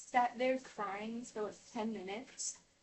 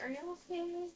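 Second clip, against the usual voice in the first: higher-pitched, patronizing concern; mock-concerned condescension, pitched up; condescending begging voice; 5 semitones higher than usual. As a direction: imitate a sister talking down to you. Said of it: "she does it in this condescending, begging voice".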